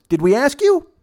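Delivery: high-pitched mocking question; retold snide rebuff. Old man rebuffs the guy leaning over, snide. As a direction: imitate a curt put-down, voice jumping up.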